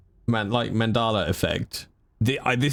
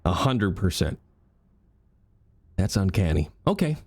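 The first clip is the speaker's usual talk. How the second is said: normal talking voice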